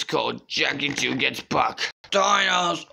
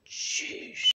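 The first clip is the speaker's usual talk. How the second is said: whisper; 5 dB quieter than usual. Pretend. Whispered aside.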